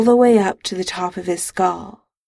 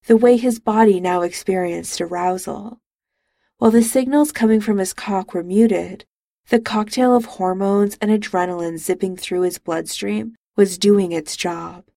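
plain reading voice

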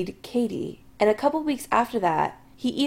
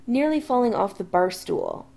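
ordinary delivery, nothing marked

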